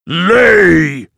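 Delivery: loud booming giant command; dopey cartoon bellow. A narrator's reading. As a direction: bark it like a dim giant. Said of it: "says the giant".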